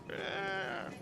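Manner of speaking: tiny whispered undead groan; zombie rat groan. Acted. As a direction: breathe a weak little zombie rat moan. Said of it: "groan like a zombie, but in a little rat voice".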